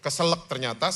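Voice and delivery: usual speaking voice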